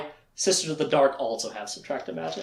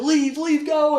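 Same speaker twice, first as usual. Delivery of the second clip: high, raised mock cry; urgent dismissal; 11 semitones higher, 3 dB louder, slower than usual. Richard sending crying Gratch away, urgent high cry. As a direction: order the creature off urgently. Acted driving creature away.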